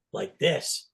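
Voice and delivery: ordinary delivery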